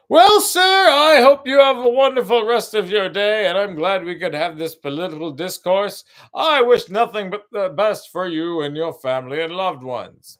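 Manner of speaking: high, loud, sweetly mocking farewell; sugary polite voice. Wishing the angry man a wonderful day, sickly sweet. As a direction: kill him with exaggerated courtesy. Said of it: "but I said that in that voice".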